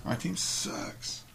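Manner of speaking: slow unvoiced whisper. Real, not acted. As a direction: breathe it out, slow and soft. Repeating his team's sad state.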